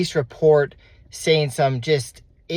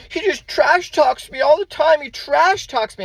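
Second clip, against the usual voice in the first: much higher, louder whiny mock of the ex; mocking his ex; 6 dB louder than usual. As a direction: sneer her complaint back in a put-on high voice. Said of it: "she's like".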